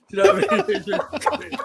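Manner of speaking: slow, laughing, high and loud. Real, not acted. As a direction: laugh through the question. Laughing at friend.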